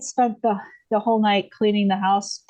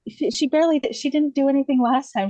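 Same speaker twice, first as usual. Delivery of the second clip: higher-pitched retold objection; husband's mild protest; 6 semitones higher than usual. Husband reassuring his wife about the midwife, mildly protesting. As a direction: lightly act out a husband arguing his point.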